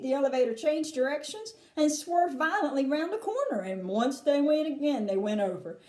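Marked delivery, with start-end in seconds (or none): none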